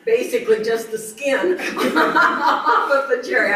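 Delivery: amused, laughter spilling over the phrase; laughing while talking. Real, not acted. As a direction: crack up mid-sentence and carry on. Amused at how little fruit.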